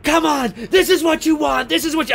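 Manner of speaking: high, goading shout-like call; acted taunting challenge. Lori offers the girl to Michael, goading. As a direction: dare the killer to come at you.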